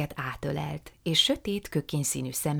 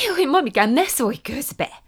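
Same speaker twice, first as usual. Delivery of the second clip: put-on higher, lively young woman; Prue's eager voice; 11 semitones higher, 8 dB louder than usual. A narrator's reading. Prue apologising to her aunt, high and eager. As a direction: apologise sweetly and brightly.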